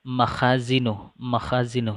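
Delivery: "Makhazinu" with a long, stressed "kha"; stress on long vowel. Shown as a demonstration. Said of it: "harokat panjang harus diberi penekanan". (tekanan panjang pada "kha" dalam "Makhazinu")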